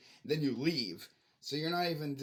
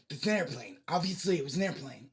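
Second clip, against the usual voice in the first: louder mock condescension; sneering imitation of a know-it-all; condescending; 5 dB louder than usual. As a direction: talk down like it's obvious.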